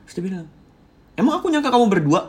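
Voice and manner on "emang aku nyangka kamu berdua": high, loud, fast retort; accusing. Retold or imitated. Partner hinting they suspect the two were alone, accusing. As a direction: mimic a suspicious partner's sharp comeback. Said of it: "dari nada dia pun dia hampir kayak menyangka".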